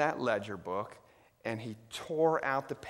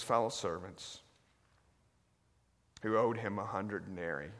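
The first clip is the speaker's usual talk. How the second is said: solemn scripture reading; 4 semitones lower, 7 dB quieter than usual. Shown as a demonstration. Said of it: "listen very carefully".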